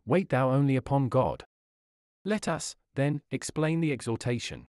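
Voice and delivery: usual speaking voice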